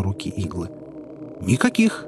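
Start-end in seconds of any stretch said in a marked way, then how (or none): 1.4-2.0 high-pitched, assured denial; confident, unwavering guide; firm. A narrator's reading. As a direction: deny it with certainty. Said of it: "твёрдо отвечал Чарли".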